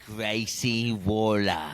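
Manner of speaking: slow, badly faked Australian drawl; bad Australian accent. Wrestling host mimicking Grayson Waller, bad Australian accent. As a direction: draw it out in a poor Australian accent. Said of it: "It's not very Australian".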